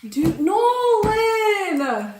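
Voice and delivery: loud, high, drawn-out exasperation; exasperated scolding. Acted. Student scolding exam partner for not knowing the battle, exasperated. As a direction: stretch the name out in loud disbelief.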